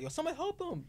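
high-pitched mock call for help; put-on high plea; mock bystander. Voicing phone-filming bystander calling out.

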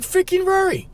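blurting a name in disbelief; exasperated outburst. Retold or imitated. Realizing Rory let the door close, exasperated cry. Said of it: "I'm like".

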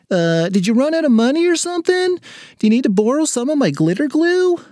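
put-on very high-pitched child's questions; schoolkid offering help. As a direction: ask like a well-meaning little classmate.

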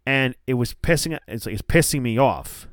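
ordinary talk

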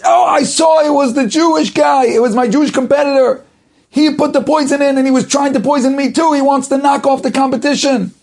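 high, loud accusing shout; furious accusation. Acted.

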